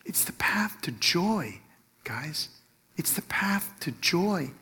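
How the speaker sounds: slow, near-whispered earnest delivery; hushed emphasis. Real, not acted. Preacher tells congregation the path to joy, hushed. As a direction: drop to a slow hush and let it sink in.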